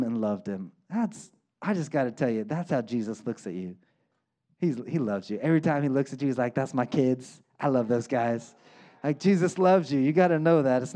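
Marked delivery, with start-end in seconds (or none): 0.0-8.5 lower, softer loving voice; tender and soft. Acted. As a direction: tell them gently how He sees them. Tender aside on Jesus' love.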